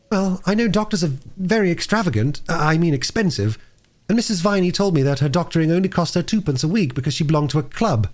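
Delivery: put-on a worried girl pleading with the doctor; a hint of a nervous child; earnest young girl. A narrator's reading. Roberta voiced throughout dialogue.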